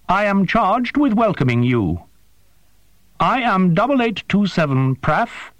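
high, precise voice of a small winged creature; formal, formal alien creature, pitched high. A narrator's reading. Creature Prath speaks.

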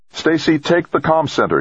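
high, urgent call across a room; shouted order. A narrator's reading. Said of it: "he shouted".

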